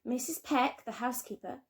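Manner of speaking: ordinary delivery, nothing marked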